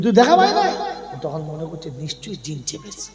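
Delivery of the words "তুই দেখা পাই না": higher, louder repeated question; insistent repeat. Retold or imitated. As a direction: push the question again, louder. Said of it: "ওই".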